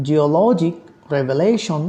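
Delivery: Geologic as geo-LO-gic, revelation as reve-LA-tion; stress on penultimate syllables. Shown as a demonstration. Teacher giving further examples, LO in geologic and LA in revelation stressed. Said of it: "এখানে stress করা হবে".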